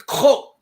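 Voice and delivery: Ko punched loud and high with a throaty start; one short guttural Ko as an order; Klingon command. Shown as a demonstration. Teacher showing how to stop someone, loud guttural Ko. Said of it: "you can just point at 'em and say".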